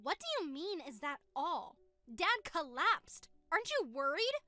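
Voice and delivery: indignant sister heard through a phone; upset, over the phone. Acted. Sister confronting her brother about Dad, upset on the phone. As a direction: push back, shocked, down the line.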